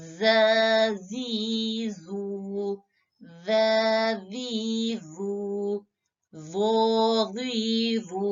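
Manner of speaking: जजीजू, जोजीजू with every vowel drawn very slowly; stretched recitation. Shown as a demonstration. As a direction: chant the long vowels slowly and evenly. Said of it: "खींच कर आपने अदा करने हैं". (जजीजू को धीरे खींचकर पढ़ें)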